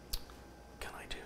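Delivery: whispered to himself, slow; a worried self-whisper. Real, not acted. Talking to himself, stuck.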